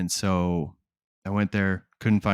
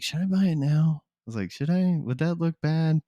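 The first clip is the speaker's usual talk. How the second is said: hesitant; 8 semitones higher than usual. Retold or imitated. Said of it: "And I was like".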